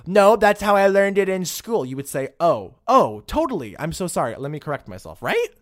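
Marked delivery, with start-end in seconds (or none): 0.1-1.9 loud, high, stubborn protest; mock defiance. Acted defiant refusal to be corrected. 2.4-5.2 quick-to-agree mock apology, starting loud; acted apology. Acted polite apology after correction.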